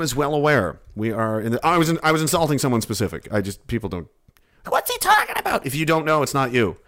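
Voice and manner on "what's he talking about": high mocking voice. Voicing a puzzled viewer.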